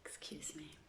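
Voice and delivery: a soft unvoiced excuse me; whispered aside. Real, not acted. Reader whispering an apology to listeners, breaking off. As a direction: whisper it off to the side, breaking from the reading.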